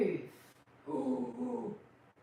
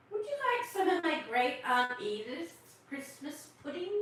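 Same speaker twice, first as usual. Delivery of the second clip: put-on much higher little-girl voice; 6 semitones higher, 3 dB louder than usual. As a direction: speak up high as the small polite girl. Martha May offers pudding.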